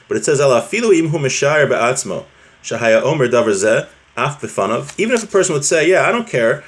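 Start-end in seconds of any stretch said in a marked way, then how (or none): none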